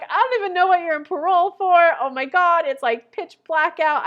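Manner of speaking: high, loud, fed-up rant; exasperated outburst. Retold or imitated. Driver venting about parole passengers in the dark, loud and exasperated. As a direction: throw up your hands in disbelief.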